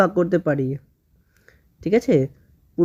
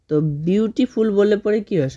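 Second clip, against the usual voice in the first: ordinary delivery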